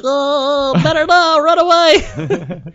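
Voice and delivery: squeaky, very high comic elf; high-pitched mock elfin yelp. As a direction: squeal it like a panicked little elf. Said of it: "elf voice".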